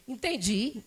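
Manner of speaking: father's flat reply. Retold or imitated. Father answering flatly.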